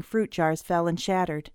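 ordinary delivery

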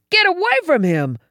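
high, urgent warning yell; alarmed, high-pitched command. A narrator's reading. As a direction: shout a warning to protect someone. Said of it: "I yelled".

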